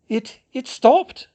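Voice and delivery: high, slow, halting amazement; disbelief. A narrator's reading. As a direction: stammer it out, hardly believing it. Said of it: "she said in disbelief".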